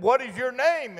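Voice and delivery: high-pitched question called into the woods; boy calling out. Retold or imitated. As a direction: call high to someone unseen. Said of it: "And I said".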